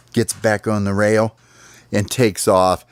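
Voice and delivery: plain reading voice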